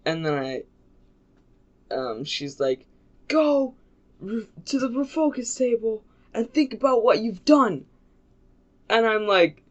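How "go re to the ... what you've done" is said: high-pitched scolding order; exasperated, raised-pitch telling-off; mock pissed-off teacher. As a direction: imitate a teacher sending a kid away in anger.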